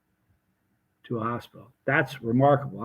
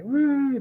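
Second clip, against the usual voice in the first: high rising siren imitation; siren whoop; 12 semitones higher than usual. Speaker imitating the ambulance arriving mid-filming, high whoop.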